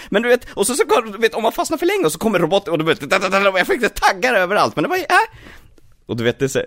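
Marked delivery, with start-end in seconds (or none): 0.1-5.0 high, excited cartoon hedgehog chatter. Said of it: "Så jag står och säger".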